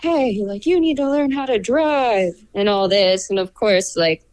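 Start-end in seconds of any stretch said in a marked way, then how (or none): 0.0-2.3 higher-pitched mock yell, no real volume; retold mock yell. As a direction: imitate an angry driver's yell without shouting. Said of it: "screaming at me".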